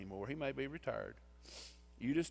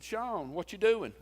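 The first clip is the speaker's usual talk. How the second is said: high, loud friendly hail; pitched-up, lively greeting; calling out cheerily; 8 semitones higher, 7 dB louder, slower than usual. Retold or imitated. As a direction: call out to someone you spot in line. Calls out to Sean in line.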